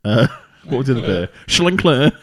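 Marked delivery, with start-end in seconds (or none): 1.5-2.1 mock German name. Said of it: "Si's gonna get us cancelled".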